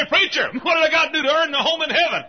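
high, loud question of a desperate seeker; shouted plea. Acted. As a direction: cry it out at full force, fast.

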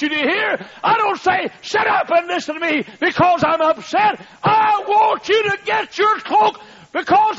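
high, loud shouted preaching; preaching at full force. Acted. Preacher warning his congregation, shouted high and loud. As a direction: thunder the warning at the pews.

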